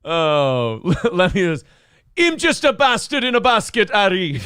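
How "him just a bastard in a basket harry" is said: high-pitched Jamaican patter; Jamaican accent. Acted. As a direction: put on a comic Jamaican accent. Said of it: "Him's going to Jamaica".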